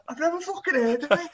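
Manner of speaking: high-pitched, incredulous retort; retold protest, voice shooting right up; exasperated denial. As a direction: insist you have no idea.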